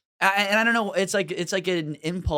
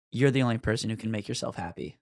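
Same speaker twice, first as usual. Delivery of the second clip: deadpan counsel to customer; 6 semitones lower, 5 dB quieter than usual. Retold or imitated. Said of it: "look them right in the eye and go".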